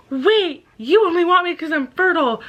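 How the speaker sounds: high-pitched imitation of an objection; mock protest. Woman stopping the horse man, high mock protest.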